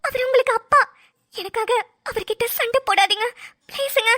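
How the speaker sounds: anxious pleading. Acted. Wife begs husband not fight.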